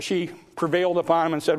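ordinary talk

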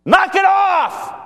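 high, forceful yell; blunt, full-voiced shouting, drawn out at the end; commanding shout. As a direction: silence a storm with a shouted order. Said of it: "telling the storm to be muzzled".

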